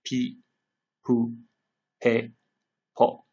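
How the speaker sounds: each voiced kana syllable said clearly with a pause after it; teacher drill, one syllable at a time. Shown as a demonstration. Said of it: "let's practice really quickly".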